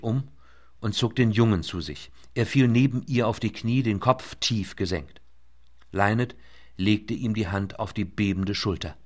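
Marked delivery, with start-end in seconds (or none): none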